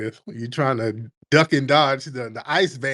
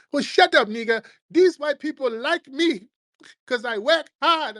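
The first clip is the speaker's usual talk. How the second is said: high, dismissive put-on voice, much higher; mock immigrant voice; 12 semitones higher than usual. Mocking immigrant brushing off the warning in a high put-on voice. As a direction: sneer it in a squeaky mock voice.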